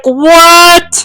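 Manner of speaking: high, loud stunned "What?"; shocked what. Retold or imitated. Fan hearing a character twist, shocked and loud. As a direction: gasp it out in disbelief. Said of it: "And I'm like".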